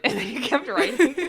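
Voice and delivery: real laugh while telling it. Real, not acted. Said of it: "laugh heard".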